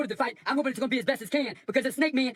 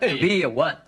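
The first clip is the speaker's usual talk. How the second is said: lower, mumbling Stallone Hamlet; Stallone impression; 9 semitones lower, slower than usual. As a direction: mumble Hamlet like a slurring tough guy. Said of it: "Stallone, you could feel like".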